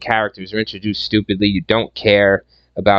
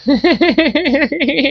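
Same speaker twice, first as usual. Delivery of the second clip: imitating his laugh. Reviewer mimics the character's laugh for his audience, high cackle. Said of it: "I'm laughing like him".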